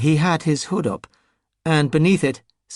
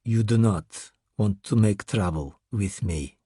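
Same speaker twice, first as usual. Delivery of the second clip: put-on Russian accent; 5 semitones lower than usual. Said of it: "said with an accent".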